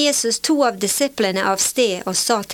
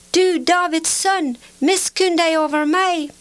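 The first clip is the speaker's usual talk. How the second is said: high, sustained cry for mercy; calling even louder; 6 semitones higher than usual. A narrator's reading. Blind man defying those who hush him, calling out insistently. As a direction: hold the pitch high and call out insistently. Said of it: "han ropte bare enda høyere".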